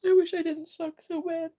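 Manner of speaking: high, teary voice close to crying; pleading through real tears; tearful. Real, not acted. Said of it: "I don't know why I'm sad".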